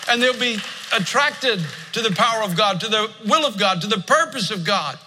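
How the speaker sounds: pitched high, building preacher cry; fervent preaching. Acted. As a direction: preach it up toward the climax. Preaching climax.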